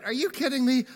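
high-pitched, mock incredulity; disbelief. Peter facing a second empty night of fishing, incredulous.

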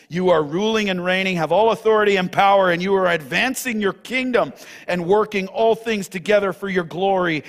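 fervent preached prayer; rising pulpit prayer. Acted. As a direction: lift the prayer to a triumphant peak.

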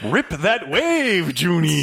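put-on slow, high, drawn-out line from an old screen star; Ricardo Montalban voice. Co-host asks to deliver the movie's best line, slow and high. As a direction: savor every word like a grand veteran actor. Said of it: "Can I do it?".

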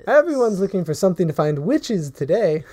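put-on high, sweet old shopkeeper lady; a chirpy old woman at her counter. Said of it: "old human lady".

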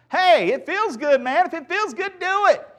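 high, loud, hammed-up party-goer; put-on anything-goes enthusiasm, stretched out; carefree hedonist. Acting out a feel-good attitude.

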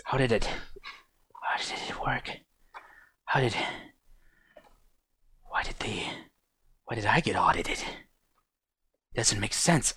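hushed, halting, half-whispered confusion; muttering to himself. Acted. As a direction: whisper fretfully to yourself in fragments. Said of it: "I remember him muttering about that".

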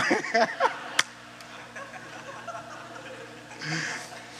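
high breathy laugh; a real burst of laughter. Real, not acted. The pastor laughs at the tough son's confession, laughing.

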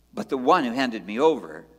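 normal talking voice

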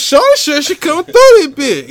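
high, slow put-on girly voice; mock girl voice. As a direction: lightly mimic her in a high voice. Retelling what a girl said.

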